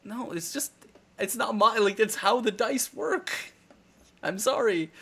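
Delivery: high-pitched defensive explaining; retold apologetic protest, pitched high; defensive reply. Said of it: "and I'm like".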